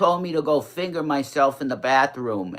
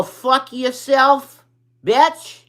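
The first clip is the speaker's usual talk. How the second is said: put-on much higher, slow, squeaky toddler swearing; 9 semitones higher, 4 dB louder, slower than usual. Showing the viewers how a toddler swears, high toddler voice. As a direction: sound like a cursing little kid. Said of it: "have you ever heard a toddler go".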